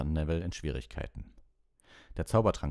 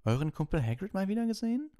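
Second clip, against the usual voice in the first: very high put-on schoolboy question; sly taunt; 4 dB louder than usual. A narrator's reading. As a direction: needle them in a high, affected voice. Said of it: "fragte er mit gedämpfter Stimme".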